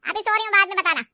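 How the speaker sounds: loud irritated brush-off; mother-in-law pestered during her nap; grumpy half-asleep. Acted. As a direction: wave her away from your bed. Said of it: "माँ नींद में ही बोली".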